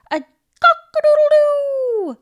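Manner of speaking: loud high crowing rooster. Acted. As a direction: crow it out like a rooster.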